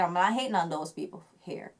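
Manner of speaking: ordinary delivery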